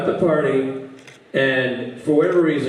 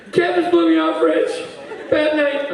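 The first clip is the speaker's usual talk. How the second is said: high, plaintive monotone; drunk Rob; 14 semitones higher than usual. Retold or imitated. Lost drunk friend phones Rich for help, high and plaintive. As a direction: whine it like a helpless, overserved friend. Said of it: "phone call from Rob".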